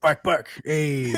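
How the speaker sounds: a comedian's joke voicing a barking dog; mock Mexican dog bark. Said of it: "what do you think a Mexican dog sounds like".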